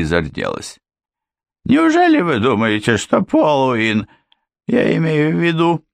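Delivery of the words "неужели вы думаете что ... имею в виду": high, slowing, flustered; flustered slip. A narrator's reading. Housekeeper flustered after her slip, high and halting. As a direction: stumble over the slip.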